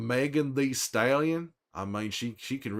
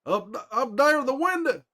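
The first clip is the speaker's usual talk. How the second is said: much higher, louder urgent callout; Tommy calling out; 12 semitones higher, 3 dB louder than usual. Retold or imitated. Imitating Tommy spotting an enemy, high urgent shout. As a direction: shout the warning up at the window like Tommy. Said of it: "Tommy went ham on Manny".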